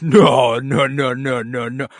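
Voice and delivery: loud, hammed-up string of no; mock loud refusal. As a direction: shout the no's as a mock protest. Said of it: "the way he was like".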